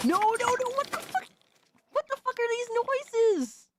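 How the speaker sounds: real disgusted outburst at gross eating sounds; horrified squeal. Real, not acted. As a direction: shriek in horror at the noises.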